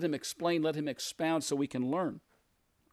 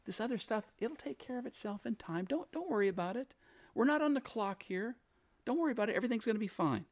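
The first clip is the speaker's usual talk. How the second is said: light, higher-pitched soothing voice, acted; calming reassurance; 7 semitones higher than usual. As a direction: reassure a flustered sister gently. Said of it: "He calms the situation".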